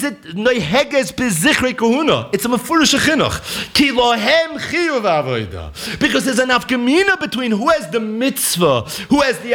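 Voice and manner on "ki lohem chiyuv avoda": slow and laughing, calling the quote out; delighted slow call-out through a laugh; laughing exclamation. Shown as a demonstration. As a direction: laugh as you call the words out. Repeats the quote, triumphant.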